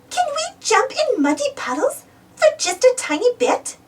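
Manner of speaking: put-on high little-girl piggy voice; a young pig asking sweetly to play; Peppa voice. A narrator's reading. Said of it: "asked Peppa".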